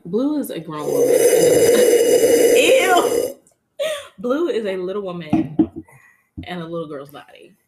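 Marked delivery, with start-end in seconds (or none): 1.1-2.5 loud breathless burst of laughter. Real, not acted. Host cracking up at the joke, loud and breathless.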